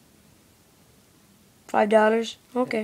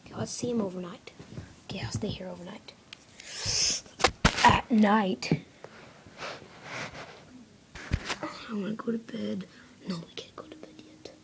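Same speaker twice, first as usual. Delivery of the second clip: fast, unvoiced plotting; whisper; 5 semitones lower, 12 dB quieter, faster than usual. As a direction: scheme it in a hush. Secret stakeout plan.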